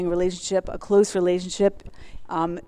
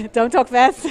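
through laughter, louder and higher; laughing; 6 semitones higher, 6 dB louder, slower than usual. Real, not acted. Telling self not to rush, laughing. Laughs at own rushed start.

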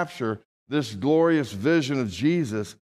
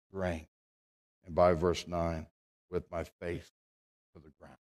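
low and much softer, fading to almost nothing; hushed reading; 7 semitones lower, 8 dB quieter than usual. Acted. Preacher reading Daniel's collapse to the church, hushed and fading. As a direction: let the voice sink as Daniel falls.

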